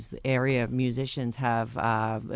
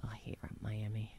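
whispering; 5 semitones lower, 20 dB quieter than usual. Real, not acted. Aside while cohost reads sports.

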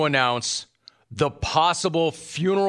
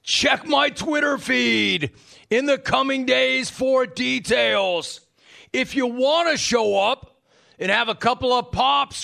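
much higher, slow, yelling Buffalo caller; Rick in Buffalo voice; 10 semitones higher, slower than usual. Shown as a demonstration. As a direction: belt it out as the rowdy caller. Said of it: "I should have read this in his voice".

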